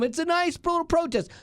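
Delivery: high, mock-dismissive sing-song; belittling imitation of protest defenders; mocking sweet tone. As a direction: sneer it like a patronizing excuse. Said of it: "you've crossed the line from".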